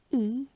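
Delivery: E said as a short vowel, as in "bin". Shown as a demonstration. Teacher demonstrating vowels, short E as in bin. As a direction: sound out E alone. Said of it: "kind of a short E".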